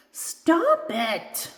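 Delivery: high, indignant protest; mock-cross squeal; cross, fighting laughter. A narrator's reading. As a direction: protest crossly while being tickled. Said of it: "says the angry doll, trying not to smile".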